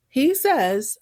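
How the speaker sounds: ordinary talk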